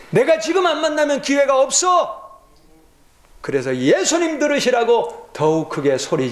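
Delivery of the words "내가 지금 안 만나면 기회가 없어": crying out. Acted. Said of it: "더욱 소리를 질러".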